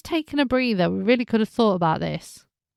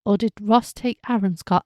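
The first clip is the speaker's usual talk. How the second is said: normal talking voice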